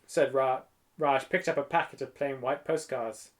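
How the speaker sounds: usual speaking voice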